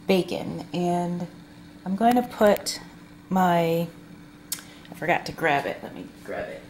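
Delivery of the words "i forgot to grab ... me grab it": breathy half-voiced aside, said to herself; whispered aside. Real, not acted. Vlogger steps off camera to fetch forgotten dressing, whispering. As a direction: mutter it under your breath as you turn away.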